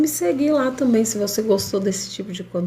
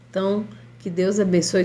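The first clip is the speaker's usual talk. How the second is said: usual speaking voice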